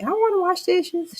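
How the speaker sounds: put-on high-pitched little toddler voice; three-year-old grandbaby. Grandmother mimics grandbaby wanting to wash dishes, little child voice. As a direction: imitate an eager small child piping up. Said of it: "my little grandbaby, she three".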